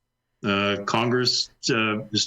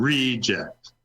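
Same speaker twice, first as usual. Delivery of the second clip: slow "reject" with RE- leaned on; deliberate "reject", weight on the first syllable; stress on first syllable. Shown as a demonstration. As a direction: say "reject" as the noun, RE-ject. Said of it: "Take the first syllable".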